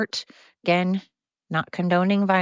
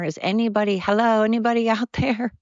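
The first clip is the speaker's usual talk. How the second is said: laughing call-out; 5 semitones higher than usual. Real, not acted. Calling out to the void.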